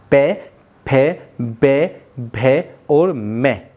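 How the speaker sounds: "पे, फे, बे, भे और मैं" drawn out, lips closing on each; very slow lip sounds. Shown as a demonstration. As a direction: stretch "पे फे बे भे मैं" slowly. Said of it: "आप उच्चारण करिए".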